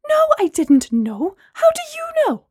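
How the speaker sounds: confronting her friend about what she hid, upset and loud; upset outburst. Acted. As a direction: hit back in disbelief. Gemma shocked, confronting friend.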